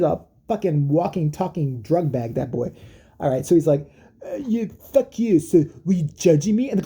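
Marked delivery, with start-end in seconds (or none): 4.2-6.7 mock yelling. Druggie accusing a girl of judging him, mock angry. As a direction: drag out the cursing in a put-on voice. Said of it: "he's just yelling at this girl".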